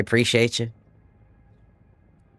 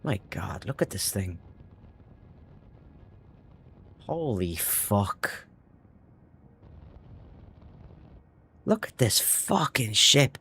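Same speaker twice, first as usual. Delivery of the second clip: awed hush; 4 semitones lower, 9 dB quieter, slower than usual. Real, not acted. Awed by the huge ship.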